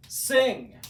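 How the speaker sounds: ordinary delivery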